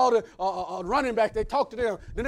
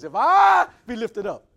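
booming, slow and much louder, voice changed; preached quote of Jesus; 9 dB louder, slower than usual. Retold or imitated. Preacher booms Jesus' words to the congregation, loud and slow. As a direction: proclaim it loud and slow from the pulpit. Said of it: "he said it like this".

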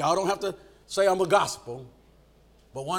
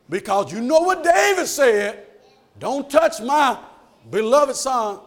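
high, loud, slow reminder; emphatic protest; 7 semitones higher, 9 dB louder, slower than usual. Acted. Soldier reminding Joab of David's order, loud and emphatic. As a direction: hit each phrase loudly, with pauses.

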